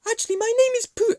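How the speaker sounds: high, quick mock Russian accent; badly faked Russian in a high puppet voice; attempted Russian accent. Puppet Putin introducing himself, high attempted Russian accent. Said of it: "a Russian accent".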